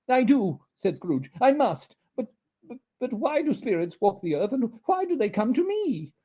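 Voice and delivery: put-on high, stammering frightened old man; frightened Scrooge. Scrooge answering the ghost, high and stammering. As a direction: stammer in fear.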